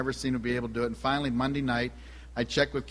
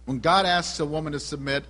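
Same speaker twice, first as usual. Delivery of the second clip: fervent preaching; 3 semitones higher than usual. Acted. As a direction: lean into each phrase like a pulpit appeal. Preaching to the congregation.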